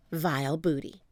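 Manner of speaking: plain reading voice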